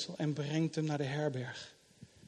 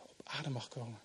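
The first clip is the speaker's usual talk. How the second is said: much softer, sinking low on adem; soft and gentle; 5 semitones lower, 12 dB quieter than usual. Acted. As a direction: let it settle quietly like a breath. Gentle invitation to rest. (zacht en rustig, "adem" laag uitgesproken)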